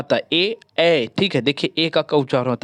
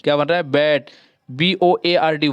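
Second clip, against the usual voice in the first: ordinary delivery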